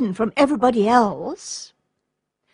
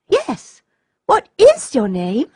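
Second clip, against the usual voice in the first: quick, eager "Yes" jumping up high; a child keen to know; 4 semitones higher than usual. A narrator's reading. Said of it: "asked Olga eagerly".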